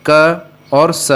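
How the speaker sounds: slow, each sound drawn out; teacher sounding out letters; slow sound demo. Shown as a demonstration. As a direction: spell out क and स slowly for students. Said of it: "सी से दो ध्वनियाँ आती है".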